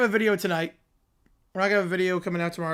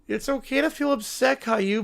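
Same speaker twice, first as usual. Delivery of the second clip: ordinary talk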